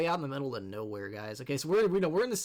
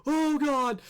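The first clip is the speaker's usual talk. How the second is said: retold dread; 9 semitones higher, 4 dB louder than usual.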